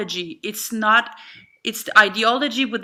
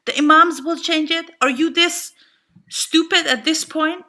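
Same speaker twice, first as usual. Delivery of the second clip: higher and louder, disbelieving echo; incredulous scorn; 6 semitones higher, 4 dB louder than usual. Real, not acted. Speaker mocks people who say imams will reform Islam, incredulous and louder. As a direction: throw their words back in disbelief. Mocking echo of naive claim.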